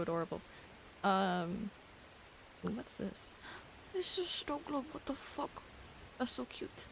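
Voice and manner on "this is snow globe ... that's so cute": higher, soft cooing voice. Real, not acted. Cooing over a cute spirit.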